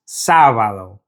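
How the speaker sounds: "sábado" with the weight on "sá"; stress on first syllable. Shown as a demonstration. Teacher showing Saturday's accent, "sábado" stressed on "sá". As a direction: lean on "sá", let "bado" fall away. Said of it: "we need to stress that first syllable".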